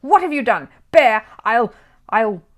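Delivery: loud, high, sputtering rage; furious sputter. Acted. Mister Curry hops about burned, furious and sputtering. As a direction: choke on your fury and trail off.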